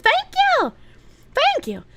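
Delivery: very high, falling thank-you; high squealed thanks. Acted. Streamer thanking a donor, high squeal. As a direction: squeal it up high and let it drop. Thanking again after alert.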